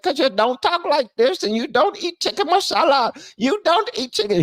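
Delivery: high put-on Indian accent; mock Indian accent. Host quoting the clip he finds hilarious, mock Indian accent. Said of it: "He said".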